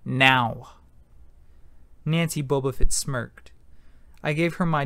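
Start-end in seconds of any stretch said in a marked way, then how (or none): 0.0-0.7 a short sharp command; barked. A narrator's reading. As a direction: snap the order. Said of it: "Missus Dodds barked at me".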